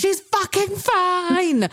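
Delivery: very high, slow, drawn-out yell; fed-up roommate yelling through the wall; mock scream. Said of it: "screamed from my room".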